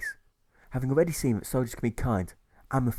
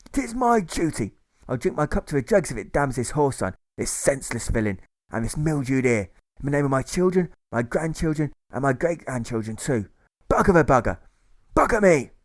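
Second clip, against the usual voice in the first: zealous ranting oath; 4 semitones higher, 5 dB louder than usual. Acted. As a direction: build the oath to a roar. Provost marshal swears zealous oath.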